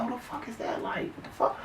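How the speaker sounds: hushed unvoiced whisper; quiet whispered who the fuck. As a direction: whisper it so nobody hears. Hearing noise by elevator.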